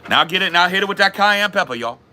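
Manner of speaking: high, hollered Cajun cook; Cajun accent. Acted. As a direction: play a Cajun cook calling out ingredients. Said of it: "How's your Cajun accent so good?".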